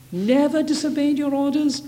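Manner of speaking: high-pitched, disbelieving question; incredulous echo. Real, not acted. Preacher challenging the elder brother's claim, incredulous. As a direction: throw the claim back in sharp disbelief.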